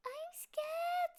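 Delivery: high small cub voice, scared; a tiny frightened cub; little bear cub. A narrator's reading.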